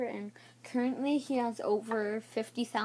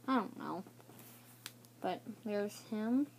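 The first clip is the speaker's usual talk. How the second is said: ordinary delivery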